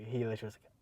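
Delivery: ordinary talk, nothing marked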